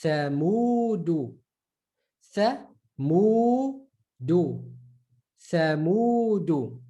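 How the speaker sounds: "thamudu" drawn out slowly; slow model word. Shown as a demonstration. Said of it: "Here we go".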